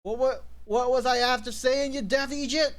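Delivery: high-pitched mock indignation; hammed-up, stammering exasperation; indignant outburst. Acted outburst of indignant game character.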